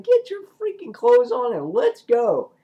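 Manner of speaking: high, loud acted yell; exasperated shouted order. Father orders daughter and boyfriend to leave, high mock-angry shout. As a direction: yell at them to hurry.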